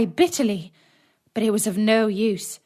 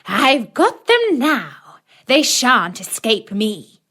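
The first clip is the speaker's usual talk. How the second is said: slow, loud, high gloating witch voice; jeering witch; 3 semitones higher, 6 dB louder, slower than usual. A narrator's reading. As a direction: gloat over the caught children, slowly. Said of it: "she laughed wickedly and said jeeringly".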